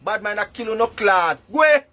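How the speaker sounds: ordinary delivery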